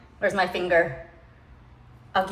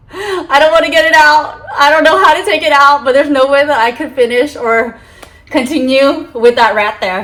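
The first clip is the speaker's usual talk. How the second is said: loud, fast, flustered rush; panicked; 6 semitones higher, 8 dB louder than usual. Real, not acted. Vlogger telling viewers she can't remove the rat, panicked. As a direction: blurt it out, rattled and grossed out.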